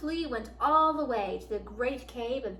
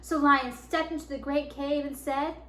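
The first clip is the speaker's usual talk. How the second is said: plain reading voice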